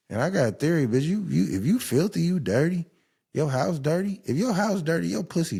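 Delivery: high, pressing indignation; heated rant. Real, not acted. Laying out his theory on dirty houses, heated and high.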